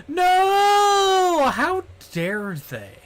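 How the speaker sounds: high shouted mock outrage; a dramatic, hammed-up no. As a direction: howl in fake betrayal.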